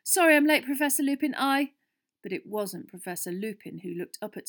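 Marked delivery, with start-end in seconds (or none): 0.0-1.7 loud, high, breaking off; hurried apology. A narrator's reading. Harry apologising for lateness to his teacher, flustered. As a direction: blurt it out bursting in.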